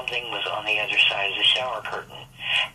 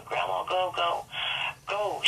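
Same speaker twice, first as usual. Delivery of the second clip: retold hysterical scream; 8 semitones higher, 3 dB quieter than usual. Granddaughter begs grandma to drive off, high panicked mock scream. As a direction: replay the girl's terror, pitched high. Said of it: "she just was screaming hysterical".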